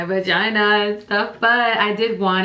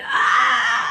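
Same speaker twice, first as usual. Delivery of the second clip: pushing scream; 13 semitones higher than usual. Retold or imitated.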